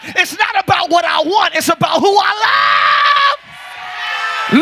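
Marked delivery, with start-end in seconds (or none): 2.0-4.6 drawn-out preaching shout. Real, not acted. Preacher peaking in exhortation.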